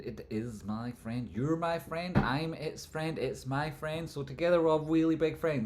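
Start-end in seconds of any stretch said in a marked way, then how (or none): 1.3-5.6 goofy higher chant; playful sing-song. Acted. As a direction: sing it like a kids' show.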